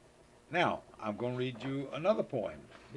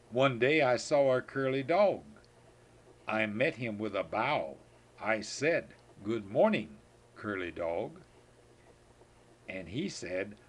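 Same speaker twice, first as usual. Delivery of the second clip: lively, louder storybook reading; reading to a child; 2 semitones lower, 4 dB louder, slower than usual. Acted. Grandpa reading the good-morning poem to his granddaughter, lively. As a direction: perform it for a listening toddler.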